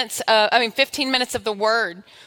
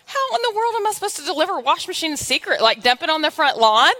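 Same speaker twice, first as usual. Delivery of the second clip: much higher, fast, disbelieving rush of words; throwing up her hands at God's odd request; incredulous retold protest; 6 semitones higher than usual. Preacher retelling her prayer, incredulous and fast. Exasperated question to God, retold.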